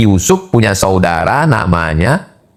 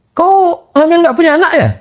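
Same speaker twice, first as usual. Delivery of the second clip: much higher mocking gossip voice. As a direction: jeer like a sneering neighbour. (suara jauh lebih tinggi mengejek seperti tetangga)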